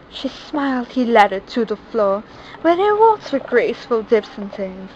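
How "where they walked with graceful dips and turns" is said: ordinary delivery, nothing marked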